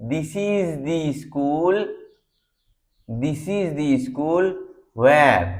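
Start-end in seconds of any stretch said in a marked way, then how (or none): 0.1-4.5 slow, "the" stressed as "thee"; emphatic "thee". Shown as a demonstration. Teacher slowly showing emphatic "the" for a remembered place. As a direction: lean on "the" as a long "thee". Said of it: "সেই উচ্চারণ বা trace দিয়ে বোঝানোর জন্য".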